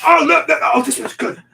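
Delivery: eager, can't wait to share; excited outburst. Real, not acted. Eager to tell a story.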